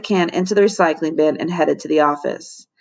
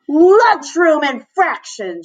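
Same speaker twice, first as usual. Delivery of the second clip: slow, higher, louder bullhorn bark; bellow through bullhorn; 7 semitones higher, 4 dB louder, slower than usual. A narrator's reading. Missus Buns bursting in on the chaos, bellowing through bullhorn. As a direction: bellow it like a strict monitor. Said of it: "she bellowed through her bullhorn".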